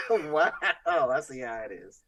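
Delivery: laughing through the words; mock offended while laughing. Real, not acted. After teasing joke.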